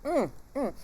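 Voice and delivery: humming through a mouthful; savoring with mouth full. Real, not acted. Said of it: "I'm sitting here with my mouth full".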